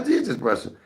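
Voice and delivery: high, indignant protest; retold upset. Son answers mama about brushing, retold upset protest. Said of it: "And I would get upset".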